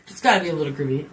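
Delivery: quick, low insistence on gotta; hurried low answer, gotta leaned on; insisting. Shown as a demonstration. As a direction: rush it, settling the matter.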